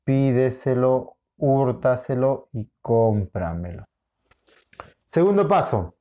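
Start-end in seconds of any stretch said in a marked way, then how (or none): 0.0-3.9 slow, measured reading of each syllable. Shown as a demonstration. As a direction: sound out every syllable evenly to check the split. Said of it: "si hemos separado correctamente". (leyendo despacio, sílaba por sílaba)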